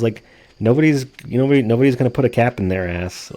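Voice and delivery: ordinary talk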